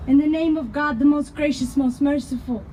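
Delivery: normal talking voice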